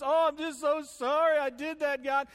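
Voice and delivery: high, loud fake-crying wail; mock tearful. Sinner crying crocodile tears to God, mock tearful. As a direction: ham up a weepy apology. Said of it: "cry big crocodile tears".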